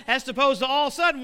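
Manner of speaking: ordinary delivery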